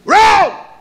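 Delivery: a sharp, high, loud cry of "wrong"; sudden shout. Real, not acted. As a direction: bark out the correction. Preacher springs a trick answer.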